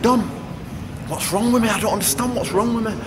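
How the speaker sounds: asking for help, not understanding; confused plea. Retold or imitated. Fighter in the corner asking trainer Dom, bewildered plea.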